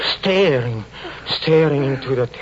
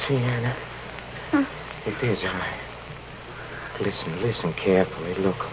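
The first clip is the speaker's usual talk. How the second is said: low, hushed coaxing; hushed, halting tenderness; tender reassurance; 5 semitones lower, 6 dB quieter than usual. Acted. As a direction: soothe her with shared memories, softening as you go. Soothing his terrified wife with memories.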